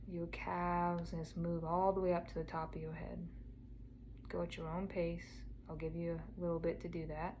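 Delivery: soft meditation voice with long pauses; guided meditation. Acted. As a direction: gently guide a listener inward, unhurried and quiet.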